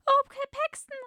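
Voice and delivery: very high, put-on girlish falsetto; tender damsel. A narrator's reading. Rescued woman to her hero, hammed-up tender falsetto. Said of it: "hauchte sie zart".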